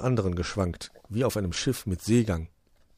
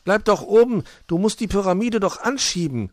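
high, loud protest; raised, pitched-up kobold voice; urgent calling up; 12 semitones higher, 8 dB louder than usual. Acted. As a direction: call up to someone on a ladder. Wuschel calls up the ladder.

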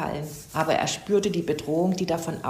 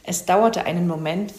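usual speaking voice

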